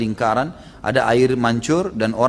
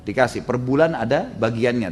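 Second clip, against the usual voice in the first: ordinary talk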